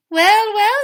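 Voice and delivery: high, sweet and sugary; wife greeting the giant, sugary sweet; sweetest smile. Acted. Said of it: "with her sweetest smile".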